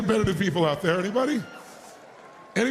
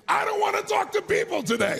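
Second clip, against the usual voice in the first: grumpy morning voice; 11 semitones higher than usual. Retold or imitated. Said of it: "wake up in the morning and think".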